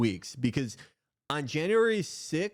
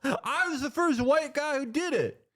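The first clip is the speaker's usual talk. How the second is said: high, mocking angry politician voice; mock Biden telling them off; mock Biden snapping; 11 semitones higher than usual. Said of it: "He was like".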